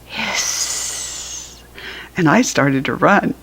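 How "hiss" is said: high, very soft whispered hiss; snake hiss. Pretend. Reading the snake's reply, soft snake hiss. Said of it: "She said".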